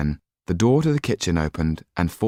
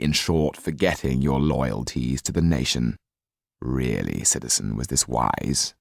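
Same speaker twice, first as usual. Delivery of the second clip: cold sneering count; 5 semitones lower than usual. Acted. Count scolding the Marquis, low and coldly mocking. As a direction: talk down to him, icy and low.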